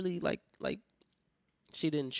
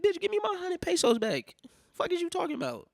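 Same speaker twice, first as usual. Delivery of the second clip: high-pitched mock-furious outburst; comic outrage at being short-changed; outraged retort; 6 dB louder than usual. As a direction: squawk it indignantly, way up high. Acts out angry reply to clerk.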